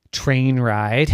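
ordinary delivery, nothing marked

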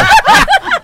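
sudden shrieking burst of laughter; loud high laugh. Real, not acted. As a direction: crack up loudly at the answer.

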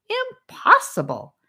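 slow, high, stunned outcry; astonished exclamation. A narrator's reading. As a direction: gasp it out in disbelief. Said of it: "Charlie exclaimed".

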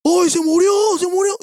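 high panicked cry, retold in fright; mock panic. Contándole a sus amigos cómo vio al hombre caer, chillido agudo de pánico fingido. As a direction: shriek it like you just saw a body. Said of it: "yo me quedé así".

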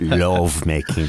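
slow Canadian love making; Canadian accent. Pretend. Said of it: "love making is not a term you can say with a Canadian accent".

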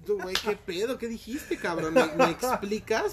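mock tough guy. Imitating rough Guerrero guy.

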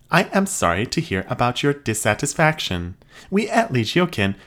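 high, even robot voice; polite machine receptionist. Acted. Robot repeats script.

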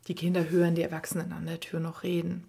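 ordinary delivery, nothing marked

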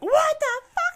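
very high, loud mock-outraged squawk; exaggerated shriek of disbelief; shocked outburst. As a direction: imitate someone utterly scandalized, shrieking up high. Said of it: "And he was like".

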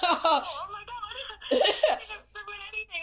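high, loud laugh-crying; overwhelmed, laughing through tears; laughing near tears. Real, not acted. Caller hearing she won free weed, laughing near tears. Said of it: "I'm about to cry".

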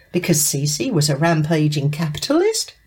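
ordinary talk

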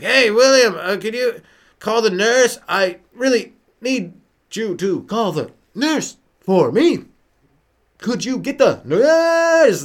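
put-on high, slow, feeble old man asking for help; dying old man. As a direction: plead slowly for the nurse. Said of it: "he was like".

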